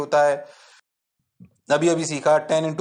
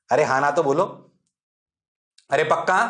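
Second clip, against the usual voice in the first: ordinary talk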